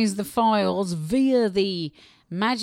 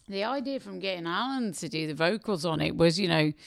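usual speaking voice

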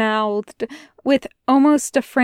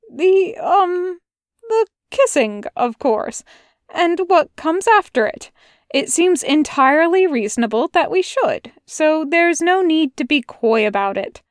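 fussy angel, flustered; 6 semitones higher than usual. A narrator's reading. Aziraphale voiced in story.

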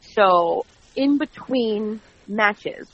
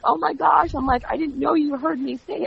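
higher-pitched, re-enacted dismay; retold flustered surprise; 7 semitones higher than usual. Host retells being overheard by the boss, flustered. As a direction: act out being caught off guard.

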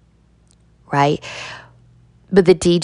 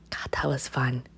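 much softer breathy whisper; 4 semitones lower, 12 dB quieter than usual. Real, not acted. Done dancing, she whispers to herself, spent. After the dance, winding down.

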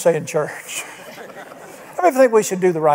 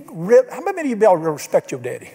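ordinary talk

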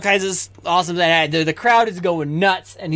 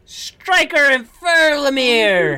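high, slow drawn-out names; ring announcer; 6 semitones higher, 3 dB louder, much slower than usual. Acted. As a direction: call the next fighters to the ring. Announcer calls next fighters.

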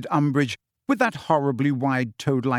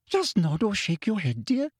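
coaxing; 7 semitones higher than usual. Acted. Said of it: "Umbridge said coaxingly".

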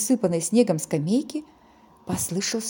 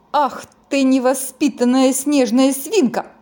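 scolding; 4 semitones higher, 6 dB louder than usual. Acted. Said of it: "ругались они".

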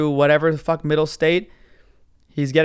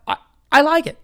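much higher, mocking driver voice; defensive justifying voice; 12 semitones higher, 4 dB louder than usual. Driver justifying doing Uber and Lyft, high defensive voice. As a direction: squeak up high as if convincing yourself. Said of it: "when you say things in that voice".

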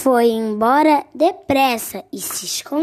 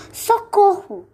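high, mock panicked yell; the scared fox yelling for help as it runs; cry for help; 10 semitones higher, 5 dB louder, slower than usual. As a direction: call for help like a fleeing fox.

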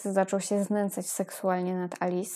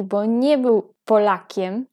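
ordinary delivery

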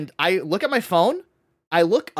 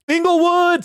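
high, loud cheer of the place name; excited shout; 12 semitones higher, 6 dB louder, slower than usual. Retold or imitated. Speaker deciding to drive out, loud excited cheer. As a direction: announce it like a rallying cry. Mock-excited call of destination.

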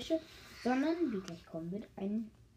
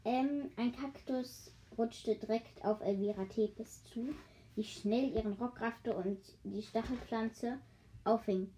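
ordinary delivery

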